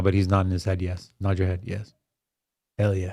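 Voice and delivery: soft, low side comment; quiet aside. Real, not acted. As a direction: murmur it off to the side. Aside about eating guest.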